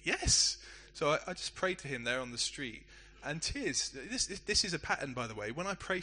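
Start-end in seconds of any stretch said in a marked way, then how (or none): 0.0-0.6 high, stunned stammer, then an emphatic yes; acted-out astonished agreement; mock dumbfounded. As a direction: imitate someone caught off guard and agreeing.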